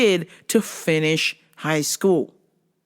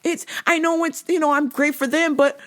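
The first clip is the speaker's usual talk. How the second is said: higher, lightly whiny; mock girl voice; 10 semitones higher than usual. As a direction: imitate the cousin doubting herself. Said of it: "I was like".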